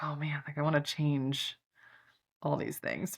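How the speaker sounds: soft, muttered thought to herself; quiet inner voice. Retold or imitated. Rereading her own book, quietly sighing over all the changes she'd make. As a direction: drop the voice as if musing privately.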